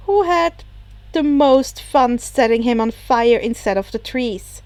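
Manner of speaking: plain reading voice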